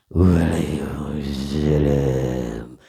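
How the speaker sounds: low, very slow, dignified kiai greeting. Retold or imitated. Kiai greets the guest with slow, low dignity. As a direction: answer with slow calm authority. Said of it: "menampakkan kewibawaannya".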